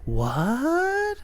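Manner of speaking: drawn-out what, swooping up high; mock disbelief. Podcaster acting out the Jedi's reply, exaggerated high disbelief. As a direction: imitate a baffled, incredulous reaction.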